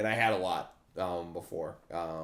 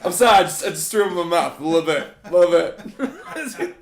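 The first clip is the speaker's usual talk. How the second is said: laughing; 4 semitones higher, 6 dB louder than usual. Real, not acted. Laughing at Star Kid joke.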